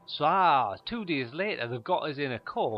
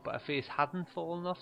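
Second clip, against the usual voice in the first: normal talking voice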